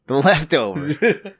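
drawn out slowly, teasing, with a rising end; mock echo. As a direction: stretch the word out to tease him. Mocking slow echo of leftovers.

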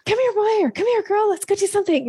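high, excited call to a dog. Shown as a demonstration. Speaker demonstrating how you call a dog, high and excited. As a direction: call your dog, all pumped up. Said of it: "you'll do this thing like".

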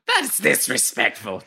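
slow, offended creature voice; Gollum. Acted. Offended at an impression, slow Gollum voice. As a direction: slip from ghoul into cave-creature voice, drawn out. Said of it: "Now I just turned to the golem".

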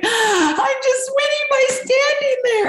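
high, slow, sobbing wail; mock crying. Retelling her backstage meltdown to Natty, mock sobbing wail.

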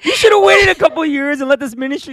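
squeaky high, loud mock outrage; high-pitched exaggerated complaint; mock outraged boss. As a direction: play himself as a shocked, whiny boss. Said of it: "I was like".

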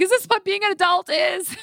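high, bewildered whine of a new adult; exasperated young self. Retold or imitated. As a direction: squeak it out like a lost young teacher. Retelling overwhelmed phone call to parents.

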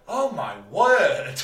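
loud, slow, mock astonishment; fake amazement. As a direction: ham up pretending it's new. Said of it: "like, going".

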